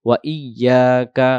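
"ওয়া ইয়াকা" as wa iy-yaa-ka; doubled Y. Shown as a demonstration. Teacher adding wa to the word, "ওয়া ইয়াকা" with doubled y. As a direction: hold the ই-ইয়া in "ওয়া ইয়াকা". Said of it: "পরে আবার হবে".

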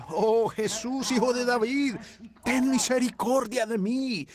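anguished cry. Acted. Blind man crying out to Jesus for mercy, anguished cry. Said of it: "En esa hora de angustia, él clamó".

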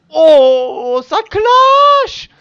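loud, high cry of delight; whooping at the burn; excited shout. Real, not acted. Gamer shouting in glee at the harsh letter.

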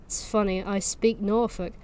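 ordinary delivery, nothing marked

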